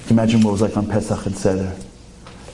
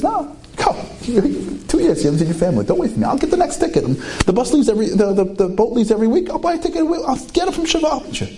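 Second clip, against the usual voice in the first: much higher, laughing insistence; urging, high pitched; 14 semitones higher than usual. Acted. Baruch telling his friend to go without him, high and insistent. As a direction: push your friend onto the boat, laughing.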